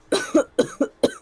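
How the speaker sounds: hammed-up dying cough; death groan. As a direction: cough out a mock death rattle. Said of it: "let me do the dying sound".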